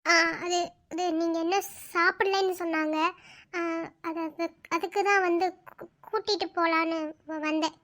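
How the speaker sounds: hesitant, soft, halting words; shy stammer. Acted. Young woman hesitantly explaining why she came, nervous. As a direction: stumble over the words nervously.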